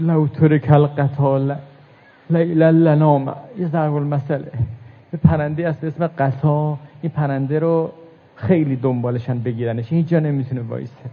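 ordinary talk

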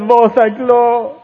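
high wailing sob; crying lament. Acted. Preacher voices Zainab's crying lament, high and sobbing. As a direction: voice breaking as she cries out. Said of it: "فریاد زد".

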